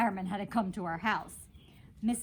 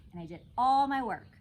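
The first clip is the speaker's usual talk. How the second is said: ordinary delivery, nothing marked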